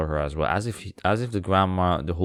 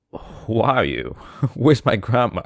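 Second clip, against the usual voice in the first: slow, higher and louder, breaking into laughter; demanding little girl; 5 semitones higher, 6 dB louder, slower than usual. Retold or imitated. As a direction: play the girl demanding answers, laughing through it. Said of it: "would be like".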